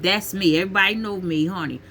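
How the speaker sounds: ordinary talk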